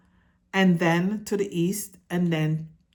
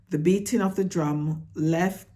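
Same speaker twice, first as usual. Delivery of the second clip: ordinary delivery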